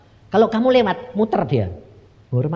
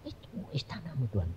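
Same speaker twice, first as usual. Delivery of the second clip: very soft whispered wonder; awed whisper; 16 dB quieter, faster than usual. Retold or imitated. Asking God about his palace, awed whisper.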